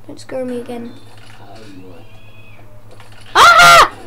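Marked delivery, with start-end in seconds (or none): 3.3-3.9 sharp high scream; startled scream. Real, not acted. Player attacked by the witch, screaming. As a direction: scream as she lunges.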